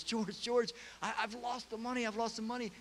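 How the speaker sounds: high, frantic plea of Uncle Billy; panicked Uncle Billy wailing he's lost the money. Retold or imitated.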